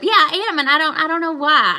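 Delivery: worked up and loud; fired up. Real, not acted. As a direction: admit it with loud, high-pitched energy. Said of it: "you are fired up".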